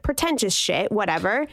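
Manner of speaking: usual speaking voice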